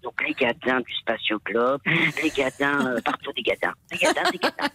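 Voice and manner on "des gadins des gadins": high squeaky voice. Retold or imitated. Mimicking puppet falls, laughing.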